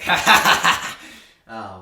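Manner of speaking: loud cackling dwarf laugh; maniacal laugh. Acted. Dwarf laughing at the chimera, loud maniacal cackle. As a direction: cackle like a madman mid-fight. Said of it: "he's just laughing maniacally".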